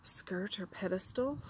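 quick whispered aside. Real, not acted. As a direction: whisper it under your breath.

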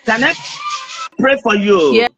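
normal talking voice

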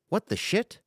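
jumps up high in shock. Acted. Wakes to find he overslept.

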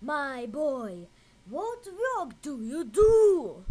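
a hint of a scheming uncle probing; uncle's voice. A narrator's reading. Uncle Abanazar questions the boy, mildly voiced. Uncle asks Aladdin in story.

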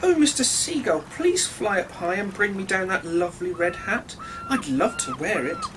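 high, eager little fish voice calling out; a small fish pleading sweetly upward; bright pleading fish voice. A narrator's reading. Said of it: "he called out to a seagull".

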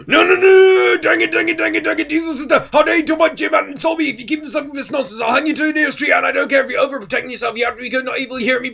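high, fast, furious ranting; a high, rushed, raging meltdown; tantrum. Acted. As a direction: throw a full screaming tantrum. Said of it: "Tantrum in five, four".

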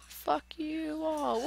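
falling cry. Real, not acted. Falling in game.

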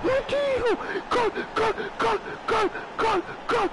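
very high, shrieking gol gol; ecstatic goal scream. Real, not acted. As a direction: lose it over the goal. Goal scored, shrieking oddio and gol.

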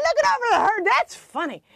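high, loud imitation of delighted grandpa; amused grandfather. Grandfather slapping his knee at the joke, high, loud imitation. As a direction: hoot it back like a tickled grandfather. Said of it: "He'd slap his knee and go".

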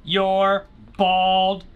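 very slow, word-by-word command; exasperated drawn-out order. Pretend. Player telling characters to move, very slow exasperated order. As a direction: space out every word as an order.

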